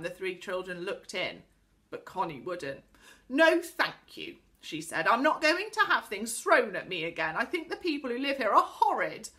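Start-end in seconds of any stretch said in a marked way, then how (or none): 3.3-4.4 slow, loud, higher-pitched refusal; sulky refusal. A narrator's reading. Girl refuses to peep again, sulky. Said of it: "She was angry and sulky". 5.1-9.3 loud, higher-pitched huffy complaint; angry and sulky. A narrator's reading. Girl refuses more things thrown at her, sulky. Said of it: "She was angry and sulky".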